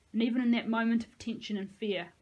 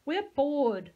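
louder, higher whine; bored whine; 6 semitones higher, 7 dB louder than usual. A narrator's reading. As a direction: whine like a brat. Bratty kid calling out.

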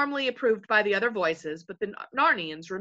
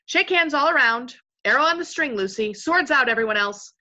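firm, louder orders before battle; rally the company to arms; rousing command; 5 semitones higher, 7 dB louder than usual. A narrator's reading. Leader readying friends to face an unseen enemy, commanding.

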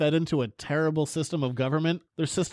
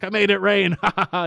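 imitating Klaue; 5 semitones higher, 5 dB louder than usual. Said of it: "in his weird South African accent".

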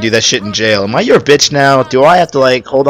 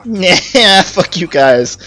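drawn-out, higher mocking dismissal; jerkish brush-off; 6 semitones higher, slower than usual. YouTuber retells snubbing viewers' requests, in a mock jerkish brush-off. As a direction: blow them off like a smug jerk, laughing. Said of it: "me being the asshole I am was like".

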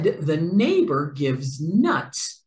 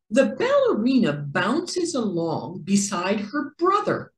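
usual speaking voice

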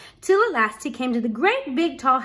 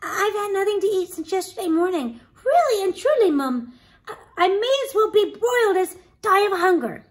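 put-on high, dramatic begging of a hungry boy; pleading Jack; 9 semitones higher, 5 dB louder than usual. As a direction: build the plea, louder on the key words.

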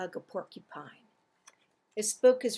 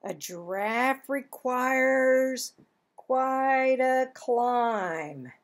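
slow stretched-out words; drawn out; 4 dB louder, slower than usual. Acted. Reading about giraffe, slow and stretched. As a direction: stretch it up like a long neck.

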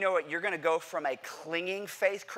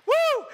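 high, loud woo; put-on whoop; 7 dB louder than usual. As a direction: whoop to look fearless. Faking bravado to seatmate.

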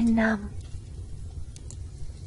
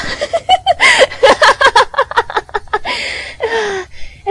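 high, loud unhinged cackle; maniacal cackle; 12 dB louder than usual. Acted. Girl cackles madly over her rival's death. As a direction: throw back your head and cackle madly.

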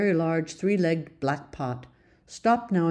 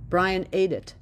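usual speaking voice

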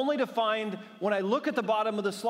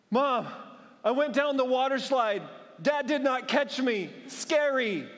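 put-on bawling child; 6 semitones higher than usual. A soaked daughter complains to her mother, hammed-up bawling. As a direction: imitate the four-year-old crying to mom. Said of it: "she's bawling like this".

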